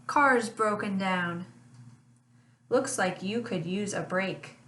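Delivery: usual speaking voice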